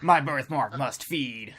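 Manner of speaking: slow, mock-ominous creature voice; drawn-out B-movie menace; ominous villain. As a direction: intone it slowly like a sinister alien. Joins in the alien villain bit.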